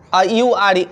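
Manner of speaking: ordinary talk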